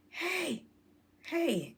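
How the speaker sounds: high calling "hey, hey"; dad calling his girl. Retold or imitated. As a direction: call up into the tree.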